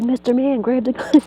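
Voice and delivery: high frantic call for help; panicked plea. Retold or imitated. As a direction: beg him to take it, flustered.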